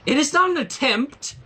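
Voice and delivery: high, loud indignant cry; cried out. A narrator's reading. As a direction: protest it with a stamp of the hoof. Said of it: "cried Trixie".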